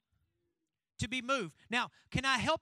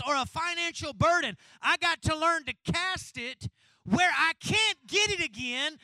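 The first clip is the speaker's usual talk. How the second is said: loud, high, driving sermon delivery; full-force preaching; 7 semitones higher, 10 dB louder than usual. Real, not acted. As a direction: drive each phrase home to the congregation.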